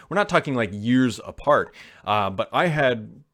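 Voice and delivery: usual speaking voice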